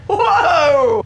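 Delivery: loud, high startled whoa; surprised whoa. Retold or imitated. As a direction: jump back with a sharp whoa. Rand startled by the groping.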